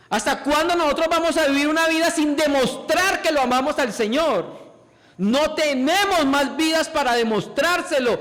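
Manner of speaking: impassioned sermon appeal; fervent preaching. Acted. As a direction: urge the church with rising fervor.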